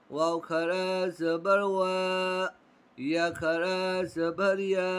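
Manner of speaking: slow recitation drill, each syllable held; drawn-out long vowel. Shown as a demonstration. Teacher reciting the letters slowly to pupils. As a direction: spell it out slowly, stretching the long a. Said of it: "खींचकर पढ़ा जाता है".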